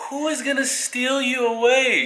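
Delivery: high-pitched mock disbelief; retold incredulity. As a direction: act baffled at a silly worry. Retells his incredulous reply to girlfriend.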